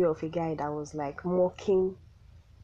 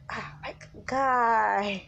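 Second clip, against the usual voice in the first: exasperated cry, pitched up on "guy"; 4 semitones higher, 3 dB louder, much slower than usual. Real, not acted. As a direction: let out a weary, raised "guy". Exasperated exclamation at fan rivalry.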